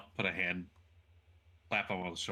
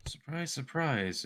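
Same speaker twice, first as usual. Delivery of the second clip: sarcastic drawl; 8 semitones higher, slower than usual. Acted.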